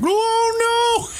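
high, shrill-free mock panic; panicked tribesman. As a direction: squeal it in mock alarm. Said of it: "tribes ... are probably like".

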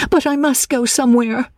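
high, urgent protest; agitated, high-pitched outburst; crying out. A narrator's reading. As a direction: cry it out high, insisting. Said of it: "she cried".

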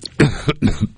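real dry cough; coughing fit. Real, not acted. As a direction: cough once, hard. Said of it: "I'm having a little coughing fit".

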